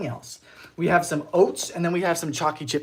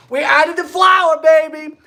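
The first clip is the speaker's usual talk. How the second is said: much higher, louder, slower hype; excited cheer; 10 semitones higher, 10 dB louder, slower than usual. Real, not acted. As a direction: belt it out like a proud cook. Excited cooking livestream announcement.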